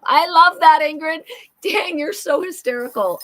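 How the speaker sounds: high-pitched burst of real delight; tickled and gushing at a friend's joke; delighted outburst. Real, not acted. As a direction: exclaim loudly, then ease off, still high.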